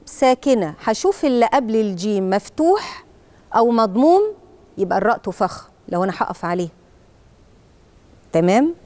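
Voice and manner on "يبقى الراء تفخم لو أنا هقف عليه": usual speaking voice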